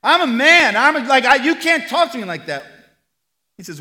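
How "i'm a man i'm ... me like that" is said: loud, high, indignant mock shouting; hammed-up outraged yell, much louder; mock angry yell. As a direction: ham up a man offended at being spoken to. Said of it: "Does he yell at her?".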